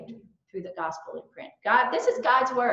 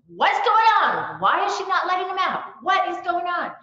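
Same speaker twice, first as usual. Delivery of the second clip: loud, higher, exasperated grumbling; 9 semitones higher, 8 dB louder than usual. Retold or imitated. Hungry mom waiting in the van, loud and exasperated. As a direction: vent your impatience loud and high.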